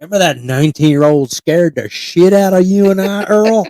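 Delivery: loud, high hammed-up hillbilly accent; hillbilly reminiscing. Local reminding Earl of the scare at Thanksgiving, hollered hillbilly accent. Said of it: "sitting around at Thanksgiving".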